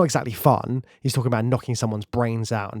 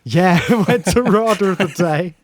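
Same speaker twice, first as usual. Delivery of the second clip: laughing; 7 semitones higher, 5 dB louder than usual. Real, not acted. Laughing agreement about Rains' voice.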